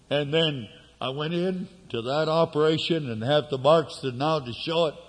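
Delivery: ordinary delivery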